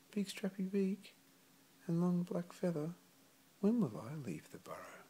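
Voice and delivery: put-on high, small childlike voice; bright high chick asking his parents; little puffling voice. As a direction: ask it like an eager little chick.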